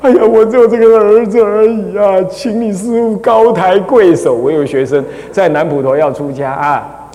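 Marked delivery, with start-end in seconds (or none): 0.0-4.3 pleading father. Retold or imitated. Master imitating a student's father begging him, mild pleading. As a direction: beg the master, a hint of an anxious old man. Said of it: "他爹都来跟我讲".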